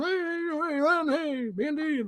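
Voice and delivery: high, soft, silly sing-song hello; imitating an annoying high greeting; teasing greeting. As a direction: needle coworkers with a put-on high voice. Re-enacts teasing greeting on walking in.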